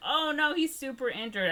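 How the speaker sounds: high-pitched mock dismay; hammed-up fretting; mock concern. Retold characters alarmed at injury.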